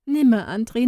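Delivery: put-on high girl's voice, rising on "Nimmer"; "Nimmer" lifted upward as a plea; first nimmer. A narrator's reading. Inga starting her refusal to Andri, rising.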